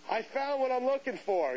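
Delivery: high-pitched mock yell; retold yell. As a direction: call it out high, as if down the aisle. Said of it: "and I yell".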